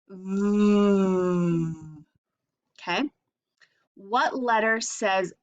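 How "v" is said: buzzing V, louder, held out; V sound demo. Shown as a demonstration. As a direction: show the mouth on a loud V. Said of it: "See my mouth?".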